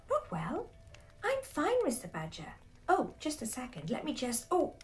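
high-pitched indignant protest; flustered young fox. A narrator's reading. Frankie protests to his teacher while itching, flustered. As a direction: insist you're fine, high and flustered.

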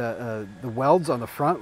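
normal talking voice

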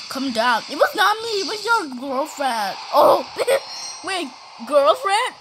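ordinary talk

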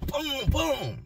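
tired mimic of kicks; door kicks. Inmate with sore foot imitating door kicks.

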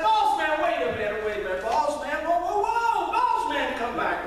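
loud calling out, high on whoa, whoa, whoa; hollering. Retold or imitated. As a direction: call after him to stop him. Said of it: "he hollered and said".

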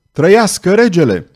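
loud, high rallying cheer; soldiers' shout. A narrator's reading. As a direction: cheer for the king as one. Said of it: "soldații strigară într-un singur avânt". (strigăt entuziast)

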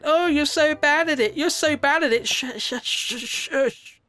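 high-pitched whiny heckler imitation; mocking critic. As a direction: mimic a nagging viewer, high and mocking, ending in shushes. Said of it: "Judging me all the time".

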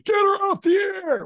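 high, loud mock shout of 'Get her off the air'; angry callers. Irate callers phoning the station, mock yelling. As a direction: yell like a furious listener. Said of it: "calls saying".

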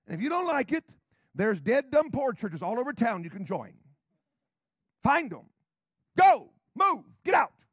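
loud, high-pitched pulpit shout; fiery sermon building to shouted commands; full-force preaching. Real, not acted. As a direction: drive the objectors out with a shout.

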